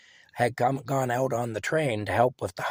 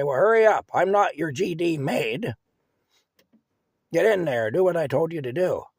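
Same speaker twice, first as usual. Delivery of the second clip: loud, high-pitched impatient scolding; bossy scolding; 6 semitones higher, 5 dB louder than usual. Retold or imitated. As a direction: snap orders at the kid loudly. Grandma bossing the narrator around.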